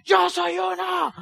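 hammed-up crowd chant; mock rally shout. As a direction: belt it like a convert at a rally. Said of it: "Martica estaba diciendo".

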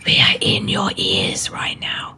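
eerie whisper in your ear; spooky whisper. Pretend. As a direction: creep them out, breathy and close. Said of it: "spooky voice after the howl".

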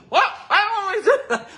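very high, loud, fast laughing squeak; imitating grandmom refusing in a neck brace; grandma voice. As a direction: protest like a tiny muffled grandma. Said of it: "And she said".

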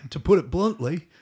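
put-on loud, high-pitched stab at an Australian accent. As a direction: finish the mock Aussie doctor's verdict. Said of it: "I can't do an Australian accent very well".

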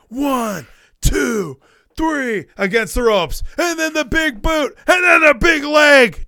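high, drawn-out ringside calls; hyped play-by-play. Acted. Cohost re-enacts Hogan's finishing sequence, excited play-by-play. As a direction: build to a shouted climax. Acting out Hogan's comeback spot.

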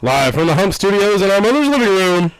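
high hyped announcer; ringmaster. Acted. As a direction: hype it up like a ringmaster. Outro announcer bit.

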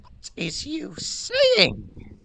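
high slow whispered street slang; fake tough gangster. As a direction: squeak it out like a tiny road man. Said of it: "and Winky goes".

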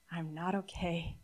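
softly confessing to a support group; quiet confession. Shown as a demonstration. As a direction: say it low and plain like a confession. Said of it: "A simple statement of truth".

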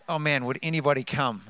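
usual speaking voice

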